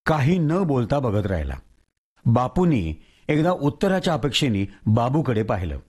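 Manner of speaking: plain reading voice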